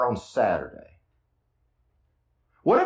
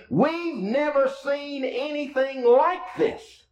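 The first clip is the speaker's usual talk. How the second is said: high, slow, awestruck cry; amazed crowd; 11 semitones higher, slower than usual. Retold or imitated. Crowd who saw the healing, lightly voiced amazement.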